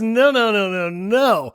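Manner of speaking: high, loud string of no's; retold firm refusal. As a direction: shut it down hard, voice up. Dad refusing firmly.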